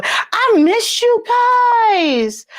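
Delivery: high, drawn-out, excited holler; gushing call-out. Real, not acted. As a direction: stretch it out high to greet the viewers. Calls out affection to her viewers.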